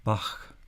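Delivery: "Bach" ending in a soft throaty ch. Shown as a demonstration. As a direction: say "Bach" with a softened K at the end. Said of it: "but softer".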